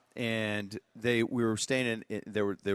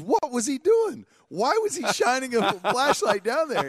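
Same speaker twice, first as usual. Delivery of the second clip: high-pitched, loud incredulous dad; mock-outraged yelling; 14 semitones higher, 7 dB louder than usual. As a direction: act out dad's furious disbelief, stretched out. Dad's outrage acted in story.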